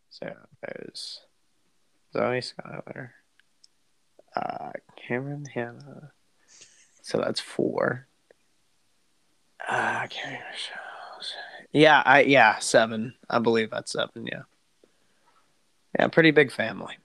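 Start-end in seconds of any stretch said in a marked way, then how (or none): 0.1-10.8 soft, half-whispered counting to himself; counting under breath. Real, not acted. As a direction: tick off names quietly under your breath. Counting sisters under his breath.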